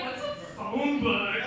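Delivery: slow, wide-eyed faux innocence through a laugh; playing dumb. Teasing her older husband, slow mock innocence. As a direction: ask it like you truly never heard of one. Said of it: "I'm like".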